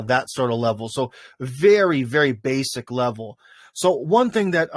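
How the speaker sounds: ordinary delivery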